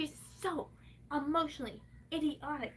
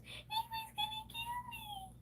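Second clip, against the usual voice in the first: high, soft mock victim voice; high mocking whine; mocking mother; 7 dB quieter than usual. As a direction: mimic her mother's high fake fear. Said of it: "then she goes".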